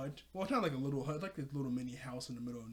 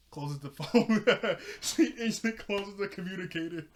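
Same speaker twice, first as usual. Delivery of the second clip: talking through real laughter; laughing; 4 semitones higher, 6 dB louder than usual. Real, not acted. Narrator amused by Raven hanging up, laughing. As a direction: crack up while telling it, voice lifted. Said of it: "That was funny".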